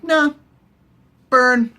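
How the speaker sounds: high, offhand mock refusal; mock dismissal. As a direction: brush it off in a squeaky voice. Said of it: "And he's like".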